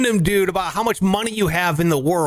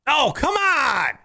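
mock protest; 8 semitones higher, slower than usual. Real, not acted.

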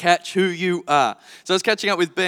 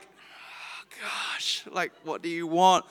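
breathy whispered aside; exasperated whisper; 2 semitones lower, 7 dB quieter, much slower than usual. Retold or imitated. Father retelling his weary reply to the toddler, whispered. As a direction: whisper it in tired bewilderment.

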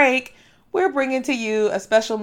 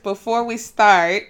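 normal talking voice

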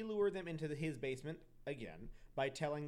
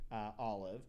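ordinary talk, nothing marked

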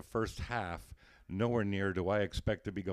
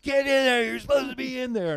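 high, loud mock shout; much higher, louder teammates' shouting; yelled orders; 9 dB louder than usual. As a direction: bellow at him to get on the field. Team yelling him onto the field.

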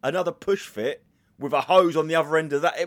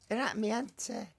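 put-on lightly done Northern accent, me auntie; a hint of a Northern aunt; 7 semitones higher, 7 dB quieter than usual. Mocking how Northerners talk, light Northern accent. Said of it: "Northerners do that".

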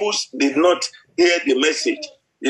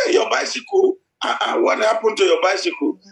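sharp high hey then questioning; surprised father; 5 semitones higher than usual. Retold or imitated. Father hearing his son lost the bike, high surprise. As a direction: jump up in disbelief. Father hears bicycle is gone.

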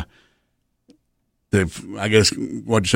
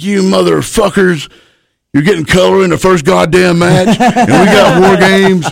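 angry veteran rant; 9 semitones higher, 5 dB louder than usual. Retold or imitated. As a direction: chew out the young guys as the old hand. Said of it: "He's like".

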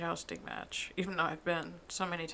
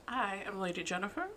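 ordinary talk, nothing marked

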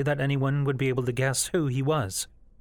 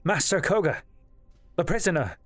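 high-pitched, urgent report; urgent alarm; 6 semitones higher than usual. A narrator's reading. Yiga telling Koga the prisoner escaped, urgent alarm.

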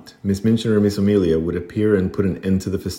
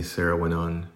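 ordinary delivery

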